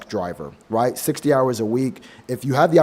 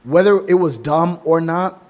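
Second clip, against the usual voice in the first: ordinary talk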